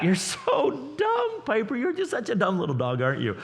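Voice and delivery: high cooing dog voice; sweet baby talk. Shown as a demonstration. Pastor sweet-talking his dog with a high coo. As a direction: coo it like praising a puppy. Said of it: "As long as I talk in a gentle tone".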